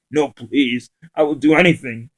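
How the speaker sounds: mock panicked plea to the attacker; panicked scream. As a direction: beg for his life. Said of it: "He screamed in a, in a groggy voice tone".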